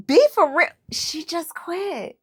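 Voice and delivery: high, exasperated disbelief; incredulous rant. Real, not acted. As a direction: call her bluff, fed up. Ranting about a contestant quitting.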